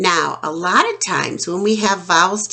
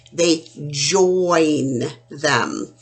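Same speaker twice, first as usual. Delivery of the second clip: slow; 3 semitones lower, much slower than usual. Shown as a demonstration. Slow demo of joining chains.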